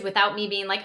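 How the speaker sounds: ordinary talk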